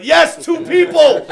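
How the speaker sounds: loud, high, slow mock cheer; triumphant yell. Second person praises his show, triumphant yell. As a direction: yell it like a huge win, hammed up.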